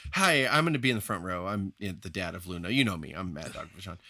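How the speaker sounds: volunteering himself. Acted. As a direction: offer yourself modestly. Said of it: "I mean him volunteering it".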